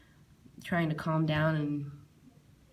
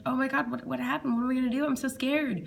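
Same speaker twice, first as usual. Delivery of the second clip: high, frightened rush of questions; panicked; 6 semitones higher than usual. Retold or imitated. Retelling seeing the plane crash on TV, panicked and high.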